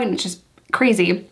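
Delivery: normal talking voice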